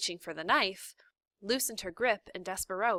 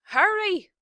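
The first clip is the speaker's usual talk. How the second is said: very high, loud yell; shouted; 12 semitones higher, 11 dB louder than usual. A narrator's reading. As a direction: shriek at her to hurry. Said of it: "shouted Cook".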